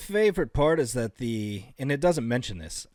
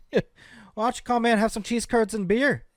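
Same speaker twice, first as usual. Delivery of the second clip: higher-pitched Midwestern invitation; hospitable Midwesterner; 6 semitones higher than usual. Acted.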